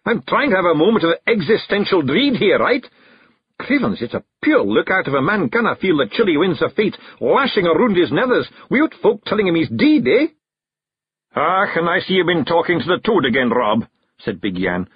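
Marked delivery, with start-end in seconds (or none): 0.0-10.3 higher, irritated Scots rant; indignant shout. A narrator's reading. Rob insists to the clan he's not dead, indignant. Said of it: "shouted Rob Anybody". 11.4-13.9 higher, knowing Scottish teasing; Big Yan, Scots clansman. A narrator's reading. Big Yan accuses Rob of toad talk, reproachful.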